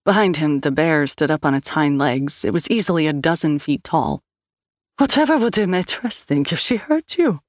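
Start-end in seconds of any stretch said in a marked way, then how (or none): 5.0-7.4 higher Swedish sing-song, hammed-up worry; rehearsed mock agony. A narrator's reading. Swede feigning concern for the Maîtresse's opinion, Scandinavian sing-song. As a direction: Scandinavian lilt, theatrically pained. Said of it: "show of agony that could have been rehearsed".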